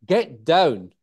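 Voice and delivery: "Get down" loud and firm, voice dropping; command with falling voice. Shown as a demonstration. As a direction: order "Get down" with a falling end on "down". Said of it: "our voice goes down".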